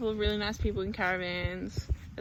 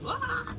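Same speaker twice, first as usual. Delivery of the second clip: stressed wail. Retold or imitated. Stressed, overwhelmed in the city.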